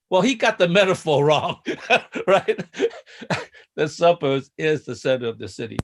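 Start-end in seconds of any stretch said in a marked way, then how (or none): 0.1-3.4 laughing through the words; tickled by your own story; amused. Real, not acted. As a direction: break into a chuckle as you say it. Amused at his own anecdote.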